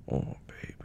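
tender whisper. Acted. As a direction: breathe it with pity. Sympathetic whisper.

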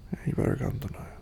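ordinary talk

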